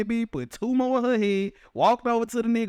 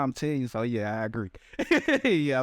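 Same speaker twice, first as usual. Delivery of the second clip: usual speaking voice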